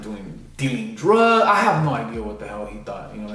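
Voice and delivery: usual speaking voice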